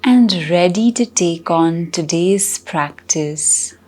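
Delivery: slow, unhurried meditation guide with long pauses; calm, measured guiding with drawn-out words; guided meditation. Acted. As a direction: ease listeners gently into stillness, phrase by phrase.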